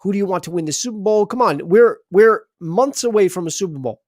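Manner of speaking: normal talking voice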